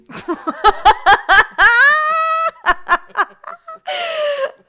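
high, loud crying laughter; helpless laughter; laughing fit. Real, not acted. Giggle fit after botched take.

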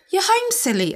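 giggly female voice. A narrator's reading. Narrator as woman greeting Byron at home, giggly. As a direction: say it girlishly, pitched well up. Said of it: "a female voice giggled".

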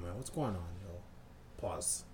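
ordinary talk

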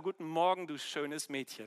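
soft and low, sinking gently; tender morning greeting. Retold or imitated. Father waking his daughter in the morning, tender greeting. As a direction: wake a child with quiet affection.

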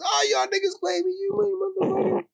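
high-pitched imitation of a furious cuss-out; high mock rage; mock angry cussing. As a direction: squeal the curse like a betrayed friend running off. John cussing while running away.

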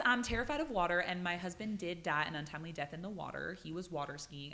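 usual speaking voice

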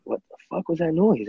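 startled reaction. Retold or imitated. Onlookers react to loud pop.

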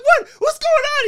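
high, loud mock panic; alarmed husband. Husband running round to his wife, acted frantic yelling. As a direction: yell it as if running to help. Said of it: "He's like".

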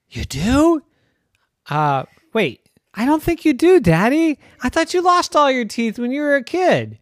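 put-on high, piping kid voice, questioning; a small child teasing daddy. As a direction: play a doubtful little kid.

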